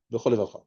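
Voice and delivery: Bechol levavcha slurred together, low; merged into one word. Shown as a demonstration. Teacher showing two words turned into one, merged. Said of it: "c'est devenu un mot".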